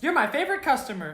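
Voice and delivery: high-pitched imitation of a flower shop lady; mock-sweet high movie voice; mock shopkeeper. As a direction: put on a high customer-service voice.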